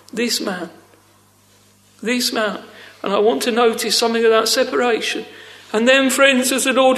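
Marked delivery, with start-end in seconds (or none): 0.1-2.6 hushed, understated contempt; softer, dropped voice for a disdainful quote; blasphemous derision. Retold or imitated. Preacher showing how Pharisees said it, understated blasphemous derision. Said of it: "in a blasphemous way".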